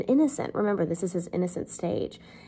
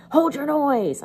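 loud, higher convict's cry; terrible voice crying out; 7 semitones higher, 7 dB louder, slower than usual. Retold or imitated. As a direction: bark it out like a scary convict. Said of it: "Cried a terrible voice".

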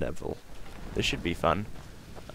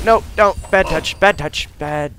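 mock panic; 8 semitones higher, 8 dB louder than usual. Real, not acted. Fending off a monster.

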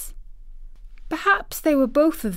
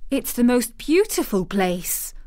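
higher-pitched, earnest protest; 4 semitones higher, 3 dB louder than usual. A narrator's reading. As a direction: protest in defence of the place. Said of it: "protested Mary".

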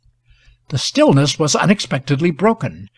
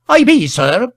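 high, louder country constable; rustic constable; 8 semitones higher, 6 dB louder than usual. A narrator's reading. Village constable answers the officer, rustic and eager.

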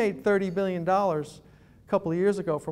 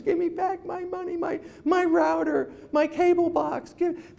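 much higher, slow whiny plea; hammed-up whimpering plea; mock begging; 9 semitones higher, slower than usual. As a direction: plead like a helpless customer. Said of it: "That's what it's like when we call".

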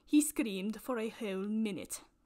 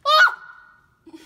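sudden high yelp of fright; startled shriek; 20 dB louder than usual. Real, not acted. Host startled by the realistic doll, with a shriek. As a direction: jump at the scary doll.

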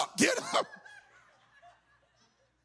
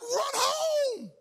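high shout to run home; urgent yell; 11 semitones higher than usual. Retold or imitated. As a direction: yell across the field, pitch way up. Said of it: "I'm like".

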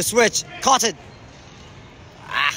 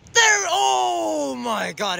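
high, very slow drawn-out cry; excited cry; 8 semitones higher, much slower than usual. Real, not acted. Gamer seeing a big chance, excited cry. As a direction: let it ring out, thrilled.

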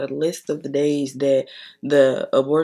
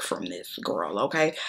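breathy whispered aside; 10 semitones lower, 7 dB quieter than usual. Real, not acted. Telling viewers about her fear, whispered aside. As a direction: drop to a whisper, confiding.

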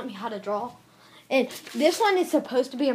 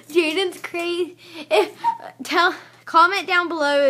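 laughing; 6 semitones higher, 5 dB louder than usual. Real, not acted. Laughing at friend.

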